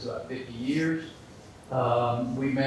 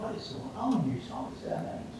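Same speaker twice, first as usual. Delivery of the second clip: nervous thought; 7 semitones higher, 7 dB quieter than usual. Acted. Nervous guy at the bar wondering about the names, panicky whisper. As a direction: panicky high start sinking to a whisper.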